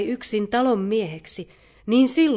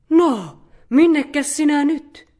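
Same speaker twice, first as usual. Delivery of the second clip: high, loud, puzzled question; astonished; 7 semitones higher, 9 dB louder than usual. A narrator's reading. Mother bear meeting the runaway nanny on the road, astonished. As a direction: call it out in amazement. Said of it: "hämmästeli karhumuori".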